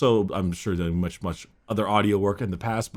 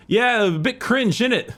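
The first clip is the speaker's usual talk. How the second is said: high, drawn-out British "innit" voice; slow British mimicry, pitched well up; imitated British accent; 11 semitones higher, 3 dB louder than usual. Host quoting a British tournament opponent, hammed-up British accent. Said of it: "came from the U K".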